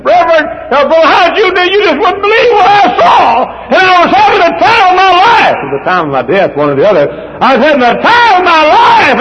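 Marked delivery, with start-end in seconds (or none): 0.1-5.6 dying man shouting his joy at seeing heaven, pitched high; ecstatic shout. Retold or imitated. Old sick man tells the preacher of heaven, overjoyed high shout. 7.4-9.2 dying man shouting his joy at seeing heaven, pitched high; ecstatic shout. Retold or imitated. Old sick man tells the preacher of heaven, overjoyed high shout.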